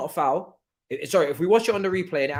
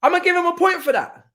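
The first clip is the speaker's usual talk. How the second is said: excited; 11 semitones higher, 6 dB louder than usual. Real, not acted. Excited about a player's move.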